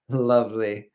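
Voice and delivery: a light British lovely with a laugh; British accent. Acted. Streamer trying British "lovely" for chat. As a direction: toss off lovely like a Brit. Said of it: "Dropping a casual lovely".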